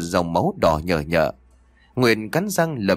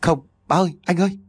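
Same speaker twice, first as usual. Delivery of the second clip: stammering plea; 7 semitones higher, faster than usual. A narrator's reading. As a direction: beg desperately, voice jumping up high. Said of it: "Nguyệt liền lắp bắp".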